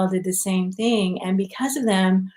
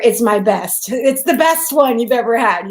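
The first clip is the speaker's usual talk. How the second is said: louder mock boast of a proud grandmother; 3 semitones higher, 8 dB louder than usual. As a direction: brag like an abuela about her mole. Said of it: "you're always gonna hear".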